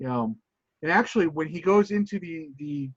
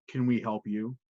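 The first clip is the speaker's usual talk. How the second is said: menacing thugs; 7 semitones lower, 6 dB quieter than usual. Acted. Thugs confront Wild Bill.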